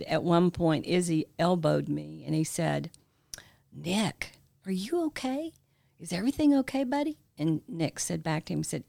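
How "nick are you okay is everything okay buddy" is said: gentle concern. Retold or imitated. Boss elbows his crying friend in church, gently concerned. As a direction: imitate a friend checking in, voice lifted high and careful.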